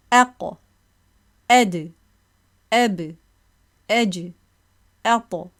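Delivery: each consonant released with a light bounce before the vowel; bouncing qalqala syllables. Shown as a demonstration. Teacher drilling students on qalqala, bouncing each letter. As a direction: tap each stop and let it spring off. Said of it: "Let's try to say this".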